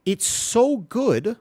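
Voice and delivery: SO GOOD stretched long, high and loud; drawn-out emphasis. Real, not acted. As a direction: lean hard on "so good", very slowly. Dramatic repeat about the camera.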